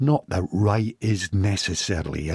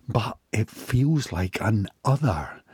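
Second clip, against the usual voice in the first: ordinary delivery, nothing marked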